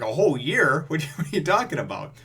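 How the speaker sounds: high, loud incredulous protest; mock disbelief. Luffy told to work a year, mock incredulous. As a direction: act out dismayed disbelief with a laugh.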